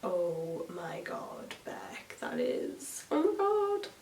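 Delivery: hushed, gasping mock horror; mock appalled whisper; mock shocked friends. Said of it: "they're like".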